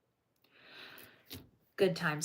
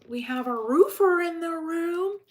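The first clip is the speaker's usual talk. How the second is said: higher, louder gleeful announcement; excited announcement; 10 semitones higher, 9 dB louder than usual. Real, not acted. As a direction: proclaim it to the room delighted. Excited announcement after outburst.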